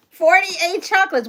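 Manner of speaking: stunned at the count; amazed. Real, not acted. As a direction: repeat it in disbelief.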